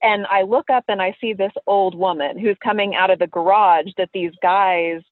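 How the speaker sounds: usual speaking voice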